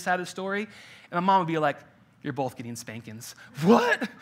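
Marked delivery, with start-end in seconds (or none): 2.2-3.3 low, quiet imitation of a stern mom; understated mock-stern mother; mom's verdict. Mom's verdict in retold story. 3.6-4.1 put-on high, louder squawk of a shocked kid; playful imitated outrage; mock disbelief. Kid's outraged reaction, retold.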